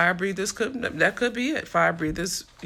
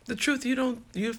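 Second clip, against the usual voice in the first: ordinary delivery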